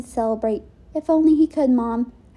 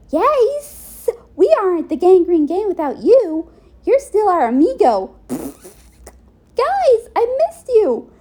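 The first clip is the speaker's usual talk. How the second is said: high, loud excited greeting; joyful reunion; 8 semitones higher, 5 dB louder, slower than usual. Acted. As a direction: cry out happily to old friends. Gang reunion, group hug.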